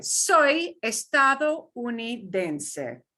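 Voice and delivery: Soy estadounidense said slowly, every syllable clear; slow model pronunciation. Shown as a demonstration. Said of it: "take this in two pieces".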